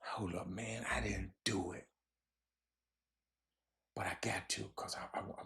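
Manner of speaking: unvoiced whisper to himself. Real, not acted. Host fumbling for the story, whispering to himself. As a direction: mutter it under the breath.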